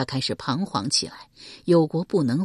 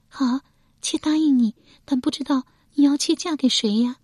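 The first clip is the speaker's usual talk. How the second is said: shy reluctant answer; 10 semitones higher, 3 dB louder than usual. A narrator's reading. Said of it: "难声说道".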